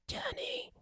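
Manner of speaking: high, very soft wheezy whisper; comical sick-friend voice. Retold or imitated. Friend teases host over the phone in a comical whispery sick voice. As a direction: put on a thin, breath-only sick voice. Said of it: "is he really gonna sound like this".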